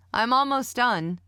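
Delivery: loud, high call. A narrator's reading. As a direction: call it across the house. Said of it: "I called".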